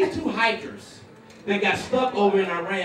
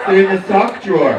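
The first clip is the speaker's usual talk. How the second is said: loud, firm mock-wife answer; instructing wife; 2 semitones lower, 8 dB louder than usual. Wife telling husband where his socks are, loud instructing voice. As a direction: lay it down loudly as the wife.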